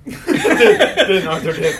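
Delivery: loud high laughing repeat. Real, not acted. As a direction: giggle the name out.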